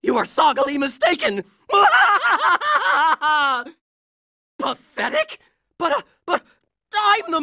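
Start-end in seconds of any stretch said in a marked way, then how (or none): 1.7-3.6 hammed-up triumphant cackle; long gloating villain laugh; evil villain laugh. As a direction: cackle like a cartoon bad guy. Villain gloats after threat.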